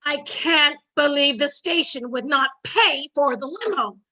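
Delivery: usual speaking voice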